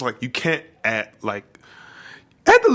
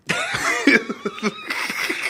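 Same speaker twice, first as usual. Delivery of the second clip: high laugh. Real, not acted. Laughing at their own joke.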